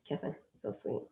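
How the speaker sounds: soft whisper of a pet's name. Shown as a demonstration. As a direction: breathe the name to a dying dog. Demonstrates whispering to her dying dog.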